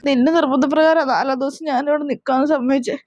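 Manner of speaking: normal talking voice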